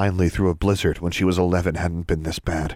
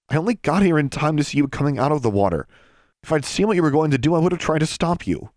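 higher and fast, defensive; defensive boy; 9 semitones higher, faster than usual. Acted. Brian hurries to defend himself to Alexandra. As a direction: rush the excuse out.

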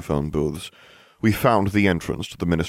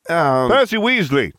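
slow, high, raised voice; shouted; 11 semitones higher, 6 dB louder than usual. A narrator's reading. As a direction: shout the name out loudly. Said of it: "I shouted".

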